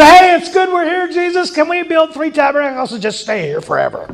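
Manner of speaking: high, eager Peter blurting out. Retold or imitated. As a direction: Peter babbling excitedly to Jesus. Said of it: "He said".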